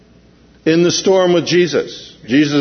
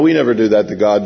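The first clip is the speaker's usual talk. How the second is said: usual speaking voice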